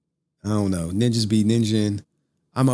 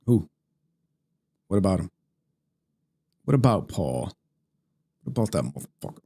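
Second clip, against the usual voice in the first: deep mock confusion; 3 semitones lower, faster than usual. Streamer pretending not to know Paul, low gruff voice. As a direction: drop low and deepen, puzzled. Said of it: "I'm like".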